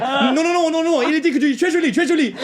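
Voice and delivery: imitating the flustered host, high and frantic; panicking host. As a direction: babble in a panic to smooth it over. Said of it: "he's like".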